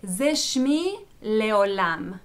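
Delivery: "Ze Shmi Leolam" read very slowly in Hebrew; Hebrew phrase. Shown as a demonstration. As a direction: spell out "Ze Shmi Leolam" word by word.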